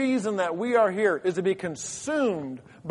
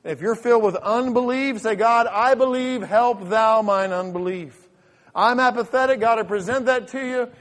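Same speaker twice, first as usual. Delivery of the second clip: loud, raised-pitch plea to God; fervent preached prayer; 5 semitones higher, 7 dB louder than usual. Acted.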